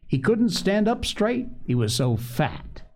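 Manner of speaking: high start dropping onto a drawn-out end; playful telling. A narrator's reading. Sister describing a fat chick to Phronsie, playful. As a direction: amuse a small child with it.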